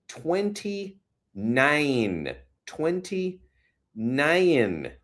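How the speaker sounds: high, very drawn-out number, each falling away; amazed. Real, not acted. Announcing the temp in N Y C, amazed. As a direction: stretch both words out in high-pitched wonder. Marvelling at a warm temperature.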